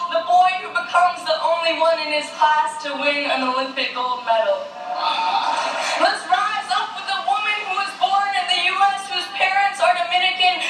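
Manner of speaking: spoken-word poem, pitched above normal speech; poem recitation. Acted. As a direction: recite it as a rousing public poem. Recites own poem on stage.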